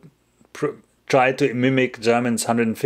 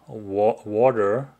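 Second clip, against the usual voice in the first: careful attempt at a Seattle accent; much slower than usual. Shown as a demonstration. Streamer showing viewer their best Seattle accent. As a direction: say "water" like a Seattle local. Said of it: "That's my best Seattle accent".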